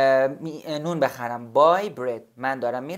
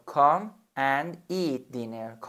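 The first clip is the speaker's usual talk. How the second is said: "Come and eat dinner" said very slowly; slow model sentence; much slower than usual. Shown as a demonstration. Teacher to student, a slow model sentence. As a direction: stretch each word for learners. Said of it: "بیا و شام بخور".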